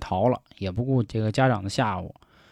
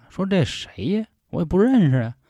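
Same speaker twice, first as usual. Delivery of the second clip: higher-pitched, quizzical self-questioning; voiced bafflement, pitched up; puzzled old lady; 8 semitones higher than usual. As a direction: act out an old woman wondering who that is. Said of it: "这老太太就纳闷儿了".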